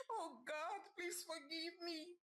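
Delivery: high, tearful prayer; crying. A narrator's reading. Mother kneeling on the floor begging God, crying. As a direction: beg God through the tears. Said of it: "Zina said, crying".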